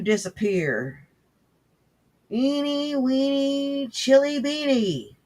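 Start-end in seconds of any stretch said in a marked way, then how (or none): 2.3-5.2 high, drawn-out incantation; sustained high hocus-pocus voice; mock magic spell. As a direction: chant it like a silly stage magician, pitched up. Mock magic spell to vanish speaker.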